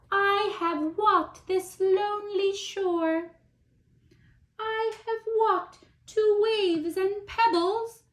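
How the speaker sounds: high, pleading turtle proposing marriage; lovesick turtle. Acted. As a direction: court her in a high, yearning voice. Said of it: "Said the turtle to the bagpipe".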